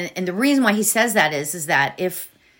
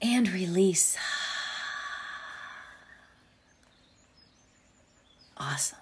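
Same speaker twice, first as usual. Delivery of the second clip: guided meditation. Acted.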